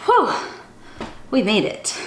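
lively, sing-song storybook reading; animated children's story voice. A narrator's reading. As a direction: read it like a chant for little kids. Reads a picture book aloud.

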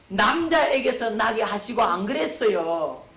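남자에게서 hit high and loud; stress on 남자. Real, not acted. Preacher rules out 남자에게서, stressing it high and loud. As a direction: punch 남자에게서 loudly as the wrong word. Preacher contrasts man with woman.

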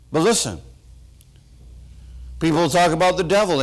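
ordinary talk

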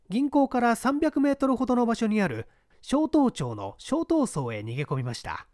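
usual speaking voice

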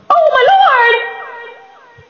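high, long drawn-out mock shock; play-acted shock. Shown as a demonstration. Second take of playing the game of shock, hammed-up shock. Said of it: "play the game of shock".

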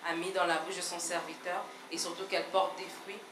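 usual speaking voice

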